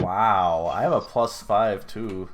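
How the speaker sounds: loud, exasperated outburst; loud exclamation. Real, not acted. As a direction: blurt it out at the bad roll. Reacting to a bad roll.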